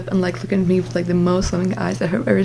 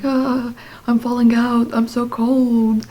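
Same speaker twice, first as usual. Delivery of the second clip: higher, drawn-out mock complaint; mock whine; 3 semitones higher, slower than usual. Cold sleeper edged off the bed, slow mock whine. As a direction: whine it slowly, pitched up.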